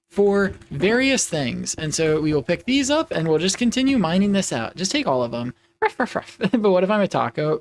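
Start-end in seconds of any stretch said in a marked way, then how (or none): 5.8-6.4 high-pitched playful ruffs; puppy barks. Pretend. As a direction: yap like an excited little dog. Puppy persona barking noises.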